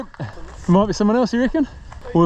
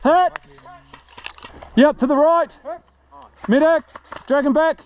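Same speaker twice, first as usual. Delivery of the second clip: shouted call; 6 semitones higher than usual. Real, not acted. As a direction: shout for the medic. Calling hit and a medic.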